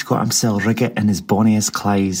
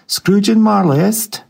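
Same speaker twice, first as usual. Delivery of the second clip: stout gentleman asking; 10 semitones higher, 4 dB louder than usual. A narrator's reading.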